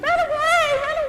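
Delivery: very high panicked cry; screaming. Acted. Israelites flee the giant in fear, high-pitched screaming. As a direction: scream it like fleeing soldiers.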